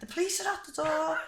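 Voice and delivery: high nan voice calling up the stairs. Retold or imitated. Nan tells her granddaughter the police are here, high and urgent.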